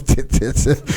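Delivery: airless little squeaks of tip; breathless squeak. Retold or imitated. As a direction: tip said high and weak with no air. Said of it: "the only thing that came out was".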